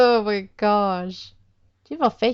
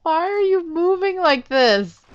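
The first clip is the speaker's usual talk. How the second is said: raised, baffled asking; puzzled question; 9 semitones higher, 3 dB louder than usual. Real, not acted. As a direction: ask it half laughing, confused. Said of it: "they're really showing off their animation".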